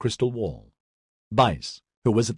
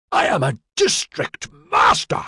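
high, slow outraged shout; indignant roar, pitched way up; furious yell; 11 semitones higher, 4 dB louder than usual. A narrator's reading. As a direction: bellow it word by word in fury. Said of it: "Bice yelled furiously".

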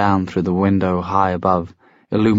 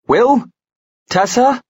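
high, loud questioning call; 5 dB louder, slower than usual. A narrator's reading. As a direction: call their names up the stairs. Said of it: "calling up to them from the entryway".

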